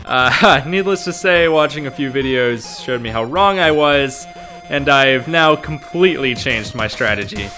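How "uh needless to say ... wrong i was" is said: ordinary talk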